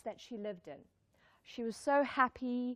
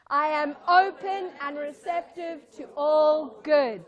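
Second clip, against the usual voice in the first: loud, slow, ringing affirmation; group affirmation; 4 semitones higher, 12 dB louder, slower than usual. Shown as a demonstration. Speaker leads the audience in an affirmation, loud and slow. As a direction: lead the room in saying it, loud and drawn out. Leading crowd in chanted affirmation.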